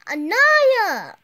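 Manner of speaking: loud high call across the room. Acted. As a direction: call her name loudly. Calling a friend.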